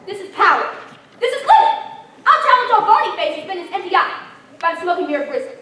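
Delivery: gloating laugh. Acted. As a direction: crow over the power with a laugh. Archchancellor gloating over his power.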